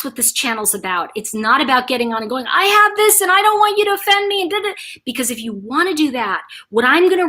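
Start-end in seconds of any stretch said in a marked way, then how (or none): 2.5-4.7 high, sing-song mocking voice; high monotone mimicry of griping; mock complainer. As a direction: parody a touchy complainer, pitched high. Mimics commenters complaining on channel.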